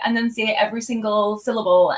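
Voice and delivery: ordinary talk, nothing marked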